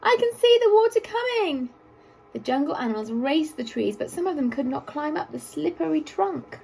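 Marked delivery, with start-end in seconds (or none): 0.0-1.7 very high, loud squawk; squawking cockatoo. A narrator's reading. Cockatoo squawking a warning at the water. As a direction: squawk it urgently like a parrot. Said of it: "squawked the cockatoo".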